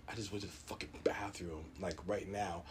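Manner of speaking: low, whispered complaint; whispered dismay. Retold or imitated. Patient dismayed at being asked for a sample, whispered dismay. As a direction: whisper it as if to yourself.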